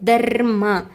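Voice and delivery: "dharma" with a long rolled R before the ma; rolled R first. Shown as a demonstration. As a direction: draw out the R in "dharma", then say ma. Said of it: "R pronunciation is there. First comes the Ra".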